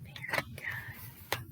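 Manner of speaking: hushed whispered praise. Acted. Librarian praising the children in a whisper. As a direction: breathe it like a secret.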